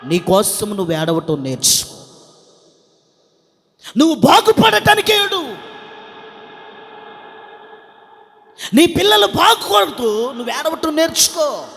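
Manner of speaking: high, loud exhortation to the congregation; full-force preaching. Real, not acted. (గొంతెత్తి గట్టిగా ప్రసంగం)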